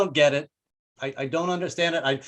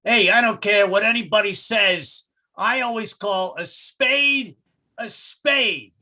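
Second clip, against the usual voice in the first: raised, stubborn declaration slowing to a drawn-out finish; hammed-up defiance, pitched up; defiant; 5 semitones higher, 3 dB louder, slower than usual. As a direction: play a blustering guy digging in. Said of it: "he's gotta stick to his guns".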